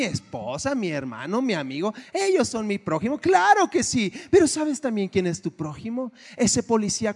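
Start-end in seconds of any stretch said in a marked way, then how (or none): 3.2-4.1 high, loud burst of agreement; sudden emphatic affirmation; emphatic yes. Real, not acted. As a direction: exclaim it with conviction.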